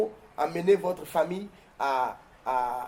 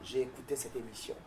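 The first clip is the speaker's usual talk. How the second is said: led prayer; 8 dB quieter than usual. Acted. Leads viewers in a prayer.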